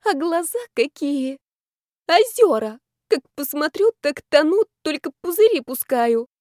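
high, gushing tipsy swoon; tender sobbing. A narrator's reading. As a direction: go all weepy with adoration. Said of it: "умилённо всхлипнула".

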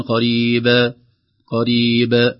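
"قريب" with the final ب overdone into "ba"; loud "قريب" with too strong a bounce on ب; exaggerated qalqala. Shown as a demonstration. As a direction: add part of a vowel after the b of "قريب". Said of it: "نحذر من المبالغة أن نقول".

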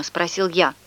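plain reading voice

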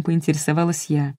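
ordinary delivery, nothing marked

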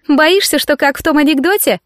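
lightly voiced derisive tease; teasing snort. A narrator's reading. Alisa teasing a fellow cadet with a joke, lightly snorted. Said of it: "фыркнула Алиса".

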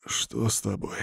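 soft, strained wheeze; wheezing. Acted. Wounded man asks the witch behind him, soft wheeze. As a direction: wheeze it out quietly, in pain. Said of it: "просипел".

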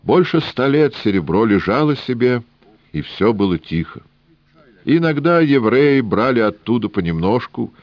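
put-on high, sing-song Yiddish-accented old man; wheedling old trader with Yiddish lilt. Rubinchik continues.

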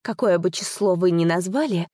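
plain reading voice

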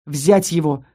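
low, restrained thunderous command; thunderous order. A narrator's reading. Newcomer ordering the count seized, low command. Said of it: "громовым приказом".

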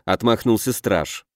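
usual speaking voice

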